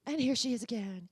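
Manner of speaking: normal talking voice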